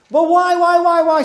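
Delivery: put-on high, loud whiny child; whining kid. Kid nags the parent with why, high and loud. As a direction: wail why like a nagging child.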